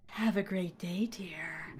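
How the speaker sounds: soft, low imitated nurse whisper; creepy whisper. Nurse handing over prescription papers to patient, imitated creepy whisper. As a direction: whisper it eerily, fading away.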